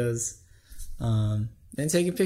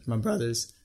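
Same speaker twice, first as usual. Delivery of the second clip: voice crack; 2 semitones lower, 4 dB quieter than usual. Real, not acted. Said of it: "Oh, that voice crack".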